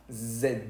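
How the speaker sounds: "Z" with a voiced buzz in the throat; throat-vibrating Z. Shown as a demonstration. Teacher shows the French Z, vibrating throat. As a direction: make the "Z" hum as French zed. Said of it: "se hace vibrar la garganta".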